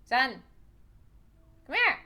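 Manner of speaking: loud, short order; commanding call. Real, not acted. Owner ordering the cat to come here, commanding call. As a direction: call a naughty pet over loudly.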